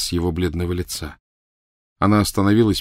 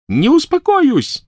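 high, loud, slow shriek; 7 dB louder, slower than usual. Acted. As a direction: shriek it back at him. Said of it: "завизжала она".

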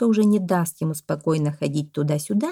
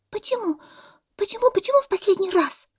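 high, agitated squeaky repeats; worried monkey. A narrator's reading. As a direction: fret in a little monkey's pipe. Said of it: "заволновалась мартышка". (встревоженная мартышка)

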